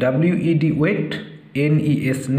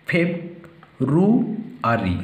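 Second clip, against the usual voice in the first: "ফেব, রু, আরি" in three parts with long pauses; syllable by syllable; slower than usual. Shown as a demonstration. Teacher dividing February for pupils, "ফেব, রু, আরি" syllable by syllable. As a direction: separate each piece of "ফেব, রু, আরি" distinctly. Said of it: "syllable তিনটে ভাগ করে দেব".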